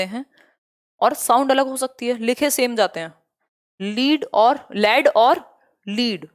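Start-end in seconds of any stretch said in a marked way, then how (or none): 3.8-6.3 drawn-out लीड और लेड और लीड, each word spaced; slow teacher demo of a homograph set. Shown as a demonstration. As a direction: sound out each word slowly for the class. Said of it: "यही same लिखे गए हैं".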